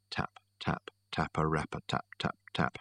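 hushed, unvoiced taps; whispered tapping. A narrator's reading. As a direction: whisper it like fingers drumming softly. Narrator imitates absent-minded tapping.

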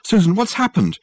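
anxious. A narrator's reading. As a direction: press her for news. Anxious phone call.

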